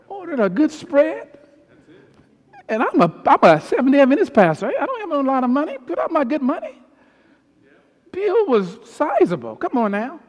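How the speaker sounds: high, loud preaching climax; preaching at full force. Acted. Pastor to congregation, preaching at full force. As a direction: drive the point home to the congregation.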